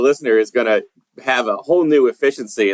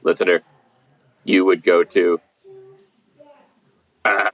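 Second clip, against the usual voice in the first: robot voice; 4 semitones lower, much slower than usual. Pretend. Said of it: "I'll do this in a robot, robot voice".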